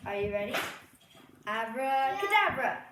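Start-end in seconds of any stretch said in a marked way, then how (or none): 1.5-2.8 grand magician's incantation; magic spell. Pretend. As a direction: announce the spell with a flourish. Magic word before trick.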